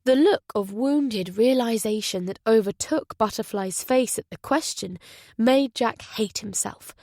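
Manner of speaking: usual speaking voice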